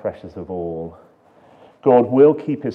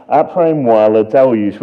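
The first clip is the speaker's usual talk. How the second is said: louder, proper French accent on the quote; 9 dB louder than usual. Shown as a demonstration. Preacher quoting Louis the fifteenth, now in a French accent. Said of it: "I didn't attempt the accent there".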